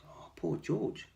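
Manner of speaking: ordinary delivery, nothing marked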